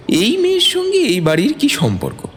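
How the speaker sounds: high, wondering village voice; curious villagers. Acted. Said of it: "তারা হয়তো ভাবছিল". (কৌতূহলী গ্রামবাসীর উঁচু গলা)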